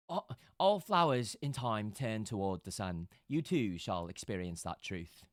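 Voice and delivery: solemn character line. Acted. Streamer voicing her cleric to chat, slow and solemn. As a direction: intone it like a holy cleric.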